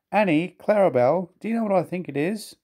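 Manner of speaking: high, voiced mock whisper; loud stage whisper. A narrator's reading. Thomas to the coaches about Gordon, stage-whispering. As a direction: confide it in a high voice meant to be overheard. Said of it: "whispered Thomas loudly".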